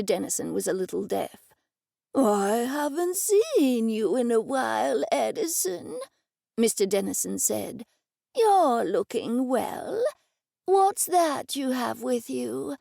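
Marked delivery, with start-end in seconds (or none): 2.1-6.0 put-on slow, shaky old man voice; the old office owner welcomes Edison, slow and quavering; elderly man. Old Mister Dennison voiced. 8.3-12.8 put-on slow, shaky old man voice; the old office owner welcomes Edison, slow and quavering; elderly man. Old Mister Dennison voiced.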